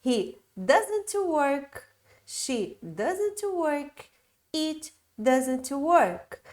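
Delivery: very slow, carefully spaced drill; slow teacher's model reading; slow model sentences. Shown as a demonstration. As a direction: spell each word out slowly for the class. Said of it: "Então, no caso aqui".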